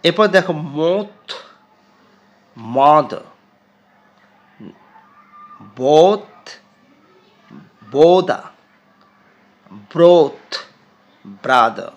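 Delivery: each word said loud and apart; teacher's word list; word list drill. Shown as a demonstration. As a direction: drill মোথ to ব্রাদার slowly. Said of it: "উচ্চারণগুলো খেয়াল করো".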